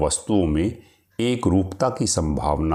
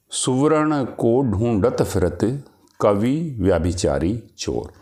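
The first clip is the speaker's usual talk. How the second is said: poem recitation; 4 semitones higher than usual. Acted. Teacher reads a verse example to the class, lifted. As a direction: recite the verse with a rising lilt.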